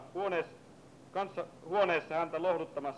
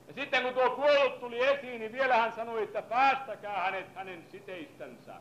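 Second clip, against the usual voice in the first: fiery preaching; 4 semitones higher than usual. Acted. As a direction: preach it hard to wake the congregation. Preaching after scripture reading.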